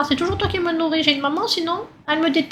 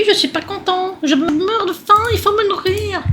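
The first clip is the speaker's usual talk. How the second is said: higher, whiny demand for food; mock baby tantrum; 5 semitones higher than usual. Hungry baby demands food in a mock tantrum. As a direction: throw a hammed-up little tantrum. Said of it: "Je suis obligée de faire un caprice".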